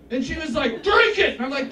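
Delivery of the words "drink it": loud, higher mock masculine order; masculine-voiced woman. Bachelorette insisting that comic drink, loud mock masculine command. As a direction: bellow it as a pushy, masculine lady. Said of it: "That's a very masculine voice".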